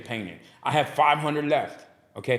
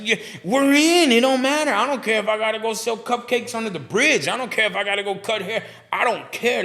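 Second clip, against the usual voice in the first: higher, louder, determined; fired-up vow; 9 semitones higher, 3 dB louder than usual. Retold or imitated. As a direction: declare it like nothing can stop you. Retold defiant vow to wife.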